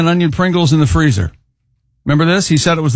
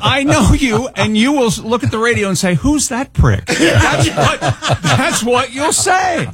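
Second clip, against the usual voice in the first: mock indignation; 6 semitones higher than usual. Real, not acted. Mock quarrel with co-host, laughing.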